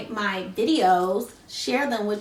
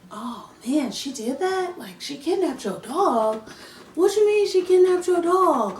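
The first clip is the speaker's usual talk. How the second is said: imitated disbelief; 4 semitones higher than usual. Office staff hearing about the kidnapped dog, incredulous. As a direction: mimic a stunned office worker.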